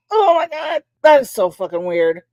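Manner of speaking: usual speaking voice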